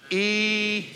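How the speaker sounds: southern preacher drawl on "Eternity"; southern drawl again. Shown as a demonstration. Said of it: "Do it again".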